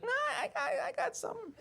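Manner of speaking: very high, soft, squeezed voice; mock straining, thin falsetto; strained squeaky husband. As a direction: squeak it out under effort.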